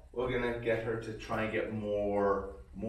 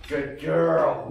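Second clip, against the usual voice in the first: ordinary talk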